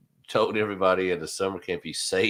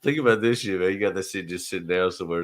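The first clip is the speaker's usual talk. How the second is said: normal talking voice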